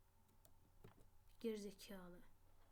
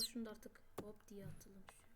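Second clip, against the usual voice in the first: soft whispered aside; 3 semitones lower, 12 dB quieter than usual. Real, not acted. Whispering to himself while placing blocks, hushed. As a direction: whisper it like nobody should hear.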